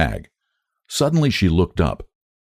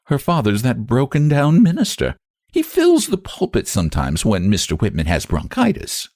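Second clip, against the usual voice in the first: slight scorn; 7 semitones higher than usual. A narrator's reading. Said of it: "with an accent of slight scorn".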